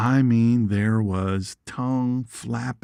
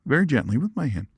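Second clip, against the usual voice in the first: ordinary delivery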